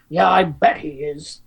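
put-on slow, sarcastic drunk father line; sarcastic drunk dad. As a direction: drawl it with bitter sarcasm. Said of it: "he goes".

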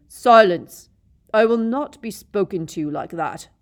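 screaming rage, very loud; shrieking. A narrator's reading. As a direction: shriek it, unhinged. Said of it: "Snape shrieked".

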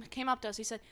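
normal talking voice

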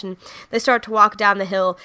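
ordinary talk

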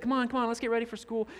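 put-on dad rushing kids out the door; hurrying the kids. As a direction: rattle it off like a rushed dad.

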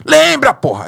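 high, loud cry of Lembra, porra; shouting at himself to remember; fierce self pep talk. Retold or imitated. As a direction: scream it to jolt yourself.

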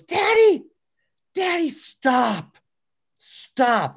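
put-on high, pleading little girl's cry; tearful plea. Retelling his crying daughter begging him to stop. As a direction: beg through tears like a five-year-old.

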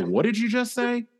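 high-pitched disbelieving question; retold "did I hear that right?" reaction; incredulous. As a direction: recoil in disbelief, pitch shooting up. Acted disbelief at friend's blunder.

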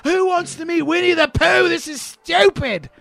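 high, slow whining rant; mock Australian tantrum; screaming Australian kid. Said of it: "He's screaming".

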